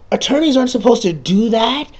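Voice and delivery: high, loud, outraged protest; indignant. Retold or imitated. Player objecting to the forging lawyer, with high, loud mock outrage. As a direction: squeal it in mock disbelief. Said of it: "and I'm like".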